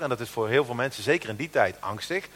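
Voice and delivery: ordinary talk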